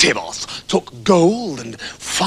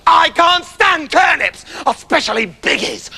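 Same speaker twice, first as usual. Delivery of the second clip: furious; 8 semitones higher than usual. Acted. Septimus storming home in a furious rage. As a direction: spit it out in fury. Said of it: "in a furious rage".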